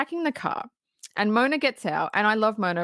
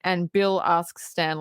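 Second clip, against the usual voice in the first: ordinary talk, nothing marked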